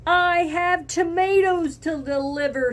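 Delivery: high, loud angry truck driver; truck driver shouting. Acted. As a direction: yell it over the honking. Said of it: "he shouted".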